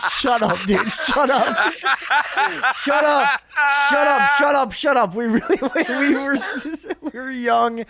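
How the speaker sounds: high, loud protest through laughter; real helpless laughter. Real, not acted. Storyteller begging laughing friends to shut up, helplessly laughing. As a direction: plead with them while cracking up. Cracks up while confessing to friends.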